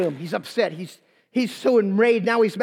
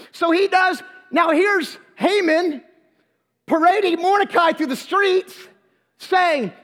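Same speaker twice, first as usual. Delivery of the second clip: normal talking voice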